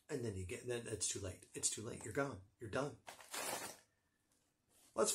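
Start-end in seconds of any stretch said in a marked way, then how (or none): 0.1-3.0 ominous hush. Acted. Spooky ghost pepper warning.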